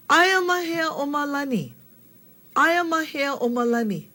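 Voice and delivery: calling out across the park; guy at the park. Retold or imitated. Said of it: "you hear one of the guys go".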